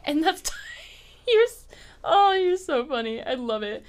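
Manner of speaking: high giggling through words; laughing. Real, not acted. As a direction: crack up mid-sentence. Laughing at friend chewing.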